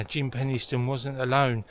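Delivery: usual speaking voice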